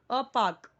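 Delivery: पार्क with a silent r, long open vowel; crisp, loud पार्क without the r; correct English pronunciation. Shown as a demonstration. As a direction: say पार्क as "paak". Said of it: "इसे पार्क नहीं पढ़ना है".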